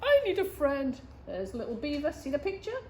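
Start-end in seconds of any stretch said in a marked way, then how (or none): 0.0-1.0 high, plaintive called-back plea; lonely echo. Acted. Voice across the pond answers Little Beaver, plaintive echo. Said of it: "across the pond".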